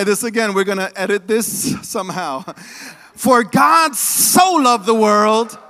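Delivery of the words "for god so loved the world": slow, joyful loud recital. Shown as a demonstration. Congregation retrying the verse with joy, slow and loud. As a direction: say it with hope and excitement. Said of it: "With some excitement, with some hope".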